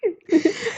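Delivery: high wild mad cackle; cackling madly. Real, not acted. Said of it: "I'm just cackling madly".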